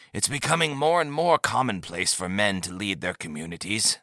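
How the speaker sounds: a hint of a soft elderly leader; lightly voiced old man. A narrator's reading. Old leader gently teasing the visitor, lightly aged voice.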